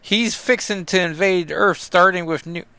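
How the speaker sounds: mock Kentucky accent, pitched a bit up. Sam warning Cap about the invasion, in a mock Kentucky accent. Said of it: "It's clearly not a Kentucky accent".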